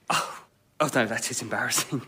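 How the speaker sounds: faked embarrassment. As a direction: pretend to be mortified.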